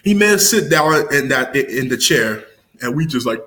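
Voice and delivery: normal talking voice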